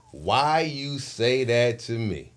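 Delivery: slow, drawn-out question; slow challenge. Retold or imitated. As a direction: stretch the question out deliberately. Repeats his challenge, second slower.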